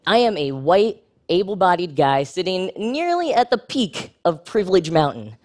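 ordinary talk, nothing marked

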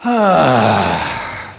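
long, loud open sigh. Shown as a demonstration. Coach leading a warm-up with the audience, long sigh. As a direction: breathe out fully on a falling "haah". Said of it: "One more time".

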